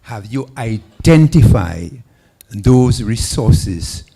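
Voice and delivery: very loud, slow, emphatic projection; speaking up. Real, not acted. Questioner restating for the forum after a request to speak up, very loud. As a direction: boom it out slowly so everyone hears. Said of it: "speak up".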